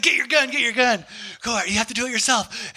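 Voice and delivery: high, urgent excited urging; imitated excitement. Hunting friend urging him to grab the gun, imitated excitement. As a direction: imitate a thrilled friend hurrying you on. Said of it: "he was so excited".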